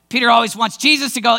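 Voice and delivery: ordinary delivery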